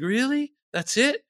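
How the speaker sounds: high, puzzled question; surprised toes. Pretend. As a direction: squeak in disbelief. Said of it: "the toes are like".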